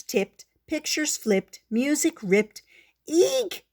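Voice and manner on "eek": very high squawked eek. Acted. Squeak squawking as the chairs tip, very high. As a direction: squawk in sudden alarm. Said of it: "Squeak squawked".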